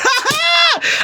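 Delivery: real burst of laughter; high shrieking laugh. Real, not acted. Teller cracking up at the tiny haul, shrieking. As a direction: scream with laughter.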